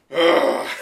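loud pained grunt; angry grunt. Retold or imitated. Sasuke grunts angrily at Frankie. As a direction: grunt in anger. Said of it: "Then Sasuke goes".

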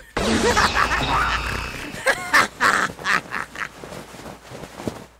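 loud high wheezing cackle; maniacal cackle. Acted. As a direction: let out a crazed laugh. Before showing devil fruit.

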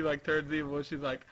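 ordinary delivery

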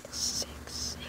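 whispered aside under the breath; muttered whisper. Acted. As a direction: hiss it very quietly. Aside muttered during the documentary.

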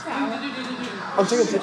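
laughing through the words. Real, not acted. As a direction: giggle it out slowly.

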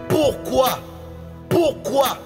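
normal talking voice

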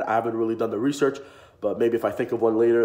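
usual speaking voice

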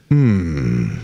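a very low, lingering hum of agreement. Shown as a demonstration. Pastor demonstrating the approving church hum, very low. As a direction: hum low like you're moved by the sermon. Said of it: "you hear something good and you go".

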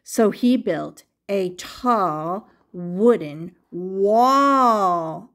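ordinary delivery

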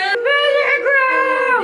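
high, long-held whoop of the name; excited cheer. Real, not acted. Answering where they are going, high excited cheer. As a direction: cheer it out slow and stretched.

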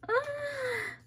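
very high, sustained little squeal; thin, high "ah" of happy excitement; delighted squeal. Real, not acted. Delighted reaction to the piece.

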